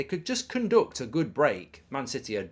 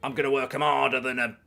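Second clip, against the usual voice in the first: Sean Dyche; 4 dB louder than usual. Retold or imitated. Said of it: "what did he say after the game".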